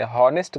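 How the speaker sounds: "हॉनेस्ट" started with a clear h; wrongly sounded H. Shown as a demonstration. Teacher telling learners this is a mistake, h sounded in "हॉनेस्ट". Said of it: "हॉनेस्ट गलत है".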